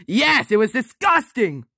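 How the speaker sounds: high-pitched exasperated revulsion; disgusted retort. Acted. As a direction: answer back thoroughly grossed out. Revolted after massaging the toes.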